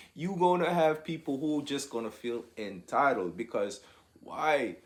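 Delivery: normal talking voice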